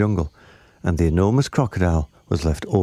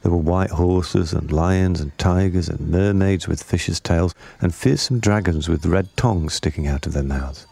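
usual speaking voice

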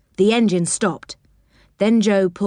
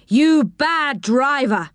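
slow, raised telling-off; scolding shout; 6 semitones higher, slower than usual. A narrator's reading. Crossing keeper scolding Joe, mock angry. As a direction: wag a finger at the driver. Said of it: "he shouted".

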